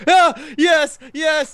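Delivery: put-on high, loud childish whoop of victory; triumphant cheer. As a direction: yell it like a kid who just won. Kid cheering after hitting brother.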